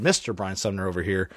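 normal talking voice

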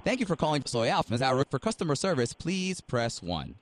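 phone menu voice. Acted. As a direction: read it like an automated phone line.

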